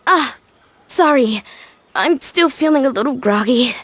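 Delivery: normal talking voice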